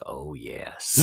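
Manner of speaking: low, soft solemn confirmation; imitating a grave little child; hushed, mock-solemn; very serious. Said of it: "Very serious".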